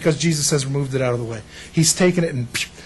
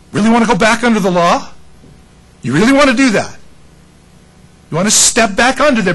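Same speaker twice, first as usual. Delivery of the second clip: Paul's challenge; 4 semitones higher, 5 dB louder than usual. Retold or imitated. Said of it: "That's why Paul in Galatians says".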